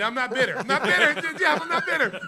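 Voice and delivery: high, shouted comic denial; loud, over-the-top defensive yelling; mock indignant protest. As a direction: protest far too loudly, laughing. Mock denial of being bitter.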